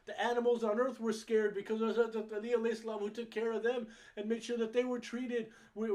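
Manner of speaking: loud, high, forceful sermon delivery; impassioned preaching. Real, not acted.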